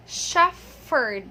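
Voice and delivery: "shepherd" with an F sound, SHEF-ferd; mispronounced shepherd. Retold or imitated. Teacher showing learners the common error, mispronounced with F. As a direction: say "shepherd" slowly as "shefferd". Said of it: "they pronounce this as".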